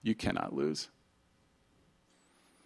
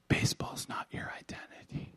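whispered; 8 dB quieter than usual. Retold or imitated. Quoting the pitcher's email.

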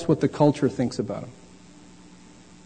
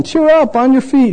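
higher, bright mock encouragement; higher hammed-up cheer; fake-cheery disciple; 7 semitones higher, 3 dB louder than usual. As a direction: pretend to be suddenly friendly. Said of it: "they called to the blind man".

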